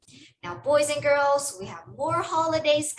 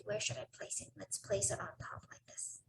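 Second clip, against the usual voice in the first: whispering; 3 semitones lower, 14 dB quieter than usual. Real, not acted. Deciding where to place flame.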